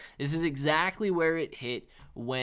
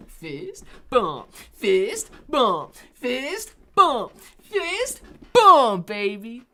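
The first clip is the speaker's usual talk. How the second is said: fist bumps climbing higher and louder to a shout; anything but monotone; 9 semitones higher, 10 dB louder, much slower than usual. Shown as a demonstration. Streamer proving his voice isn't monotone, escalating hyped shouts. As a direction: build each repeat into a high, hyped yell. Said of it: "There's no monotony in this voice".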